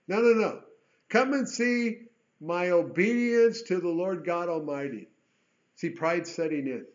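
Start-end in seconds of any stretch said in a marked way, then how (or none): 0.1-5.1 loud, raised, insistent objection; emphatic correction. Acted. Preacher correcting Jehu's prideful boast, loud and emphatic. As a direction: correct the boast forcefully, pitch and volume up.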